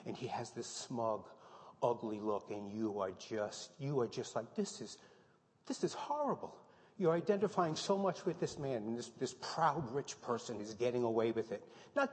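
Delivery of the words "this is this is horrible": high, hushed horrified voice; a viewer's breathless dismay; horrified gasp. Retold or imitated. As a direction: sound appalled, pitched way up. Imagined viewer reaction to villain.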